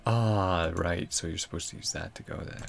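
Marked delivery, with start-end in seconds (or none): none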